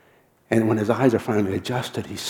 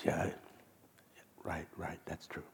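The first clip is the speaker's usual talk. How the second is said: voiceless, much softer mumble; resigned whisper; 4 semitones lower, 15 dB quieter than usual. Retold or imitated. Al agreeing with Pam, in a resigned whisper. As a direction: concede it under your breath. Al conceding quietly.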